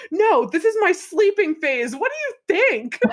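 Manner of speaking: high, sarcastic mock yell. Dee Dee in labor answering Lucia, high mock yell. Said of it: "violently screaming".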